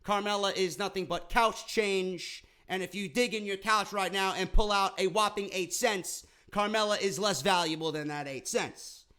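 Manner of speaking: ordinary talk, nothing marked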